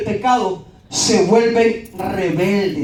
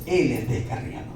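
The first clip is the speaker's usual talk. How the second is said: lower, soft inner voice; voice of God; 10 semitones lower, 8 dB quieter than usual. Retold or imitated. God telling the preacher the man has strayed, lower and soft. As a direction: murmur it like a revelation.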